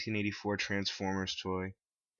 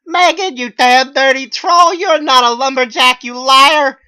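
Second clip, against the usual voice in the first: much higher and louder, accusing; imagined furious shout; 23 dB louder, slower than usual. Pretend. Narrator imagines screaming at Megan, much higher and louder. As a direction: scream it at her, call her a liar.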